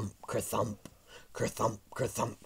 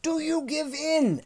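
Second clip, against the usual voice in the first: very high, loud challenge; shouted demand; 7 dB louder than usual. A narrator's reading. Boy demanding dinosaur's surrender.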